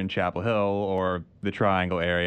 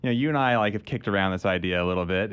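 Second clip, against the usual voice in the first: usual speaking voice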